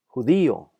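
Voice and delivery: Judío with "dí" punched loud; stress on accented syllable. Shown as a demonstration. Teacher showing words with tilde, Judío stressed on "dí". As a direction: hit the middle "dí" of Judío hard. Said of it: "sílaba que necesita ser enfatizada".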